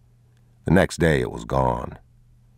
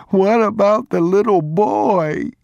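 higher, louder and slower, breaking into sobs; a child sobbing, more desperate; harder sobbing; 5 dB louder than usual. A narrator's reading. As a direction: wail it as the boy's grief builds. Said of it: "he sobbed".

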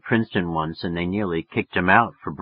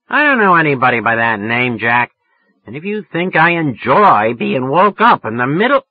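louder, fierce woman's voice; tigress; 4 semitones higher, 7 dB louder than usual. A narrator's reading. Woman woken in the night, telling off the caller fiercely. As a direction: tear into the caller. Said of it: "a real tigress over the phone".

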